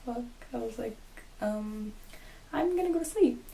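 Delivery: very quiet, under the breath; barely voiced private mutter; muttered to self. Retelling a night awake beside friends, muttered to self.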